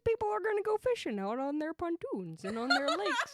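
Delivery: put-on a lightly done Minnesotan accent, sunny and chatty. Doing a Minnesotan accent for a podcast accent game. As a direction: lay on the Minnesota vowels, cheerful.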